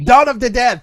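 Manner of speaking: loud high call; big shouted announcement. Acted. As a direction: belt out the title. Excited title call.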